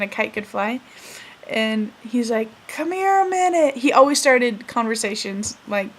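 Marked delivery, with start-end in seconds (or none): 2.7-3.8 higher voiced old man beckoning; old grandpa calling over. Grandpa summons neighbor kid, beckoning old-man voice.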